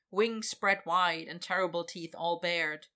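ordinary delivery

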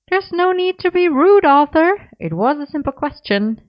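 feigning hurt innocence; mock offended. Gwen mock-offended at Arthur.

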